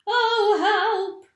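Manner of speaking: very high, very loud cry for help; screaming. A narrator's reading. Missus Twit feels giddy on the ceiling, high loud screams. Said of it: "screamed Missus Twit".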